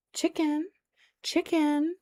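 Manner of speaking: soft, high chicken call; mock-mystical gentle summons; chicken whisperer. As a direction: coax a hen over, high and drawn up. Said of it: "become the chicken whisperer".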